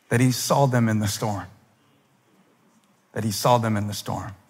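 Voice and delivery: ordinary delivery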